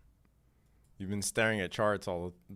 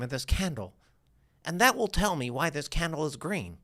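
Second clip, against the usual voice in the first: higher, swooping voice of a naive trader; put-on questioner voice; 3 semitones higher than usual. Mocking a trader who wants headlines for every candle, put-on questioner voice. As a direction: put on a voice unlike your own. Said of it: "I just did a voice that doesn't sound like me to differentiate".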